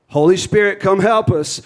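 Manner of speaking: loud fervent plea; fervent call. Real, not acted. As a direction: cry out to the Spirit. Sudden loud call to the Spirit.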